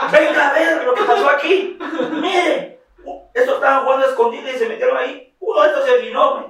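high, loud staged tattling through laughter; hammed-up fake innocence. Blaming the kids to his mom in overacted innocence. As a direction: sell the lie, laughing. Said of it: "ya hablo como que bien actuado".

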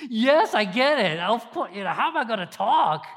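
laughing through the words; real amused laugh. Real, not acted. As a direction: laugh at your own fear. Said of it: "I couldn't talk".

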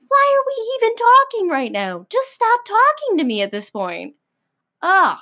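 high-pitched fed-up rant ending in a groan; exasperated outburst. Acted. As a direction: voice shoots up, at your wits' end. Fed up with one-upper.

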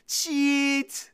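very drawn-out high holler; sing-song bellowed name; yelling. Acted. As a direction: stretch each word into a long loud yell. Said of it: "Both boys yelled together".

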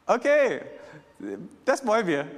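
high, loud, enthusiastic agreement; eager crowd. Acted. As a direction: jump at the offer like an excited crowd. Voicing the crowd's keen response. (begeistert zustimmend)

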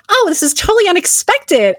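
high-pitched feigned surprise; exaggerated coy protest; mock coy. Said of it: "like, coy".

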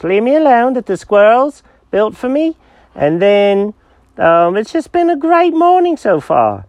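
put-on high-pitched, delighted cat with an accent; pleased-as-punch little cat; cheerful cat Jenkins. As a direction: gush happily in a high character voice.

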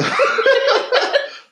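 squeaky burst of laughter; high giggle. Real, not acted. Struggles with hard word.

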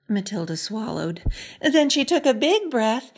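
ordinary delivery

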